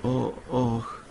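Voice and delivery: hesitant, woeful oh; reluctant groan. A narrator's reading. Husband dreads stating the request.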